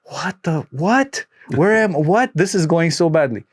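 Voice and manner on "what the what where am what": high, bewildered stammer of what; lost and flustered, voice jumping up; baffled. Retold or imitated. She retells her bewilderment at the teasing tech, high and flustered. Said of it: "I'm like".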